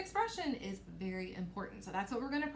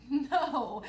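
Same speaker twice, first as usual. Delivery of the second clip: laughing; 6 dB louder than usual. Real, not acted.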